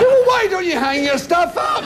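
high-pitched comic outburst; mock-exasperated holler; exasperated shout. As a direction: yell back at a whinging celebrity. Scolding complaining celebrities.

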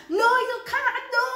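retold scream. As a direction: shout it out like losing your temper at a friend. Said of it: "I'm screaming and I'm raising my voice".

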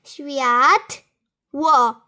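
"światło" very slow, cut into "świat" and "ło"; "światło" with a long first syllable and a pause; split into syllables. Shown as a demonstration. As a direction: sound out "światło" for counting. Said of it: "Weźmy światło".

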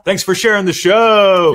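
high, slow, loud sign-off call; enthusiastic sign-off. Acted. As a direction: belt out the show thanks. Sign-off catchphrase called out.

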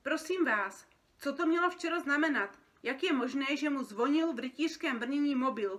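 indignant complaint. Acted. Feathered creature complaining by phone about costumes, indignant and loud. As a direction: demand answers down the line, exasperated. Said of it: "Stěžoval si jiný opeřenec".

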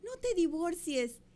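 put-on high-pitched pleading child; mock tearful kid voice, high; imitated crying little boy. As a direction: draw it out like a little boy begging his parents. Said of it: "un niñito que lloraba y decía".

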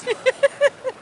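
kurzes, sehr hohes Kichern. Real, not acted. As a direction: leise über sich selbst kichern. Lacht nach eigener Ansage. (hohes kurzes Kichern)

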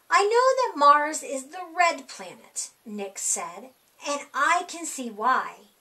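usual speaking voice

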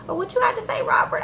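sly whispered jab; gossipy clerk whispering to stir trouble. Retold or imitated. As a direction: lean in and whisper the dig. Clerk goads Robert in aside.

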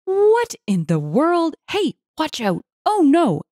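high-pitched alarmed outcries; onlookers' exclamations. A narrator's reading. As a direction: exclaim in shock as a startled bystander. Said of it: "exclamations from the onlookers erupted".

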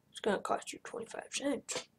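unvoiced hushed aside; whisper. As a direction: whisper it slyly.

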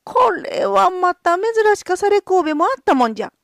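high, loud astonished cry; astonished exclamation. Acted. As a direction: marvel out loud. Gonzo exclaims at the singing skull. (驚いた声)

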